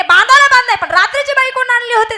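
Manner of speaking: husband bitten, yelling at his wife, loud and high; angry shouting. Acted. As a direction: yell at him in pain and fury. Acted quarrel continues.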